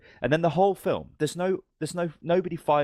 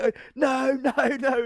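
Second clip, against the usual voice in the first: high, drawn-out warning cry; mock alarm; slower than usual. Soldiers warning a rescuer in the minefield, mock alarm. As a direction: imitate panicked soldiers, pitched way up.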